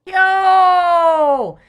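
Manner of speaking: hyped rapper greeting; rapper shout. Acted. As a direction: call it out like an MC. Rap star entrance.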